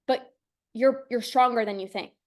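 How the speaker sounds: ordinary delivery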